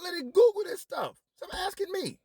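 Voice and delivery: very high, squeaky fed-up voice; high cartoonish exasperation; squeaky exasperated outburst. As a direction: shriek it like you've had enough. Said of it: "I'm like".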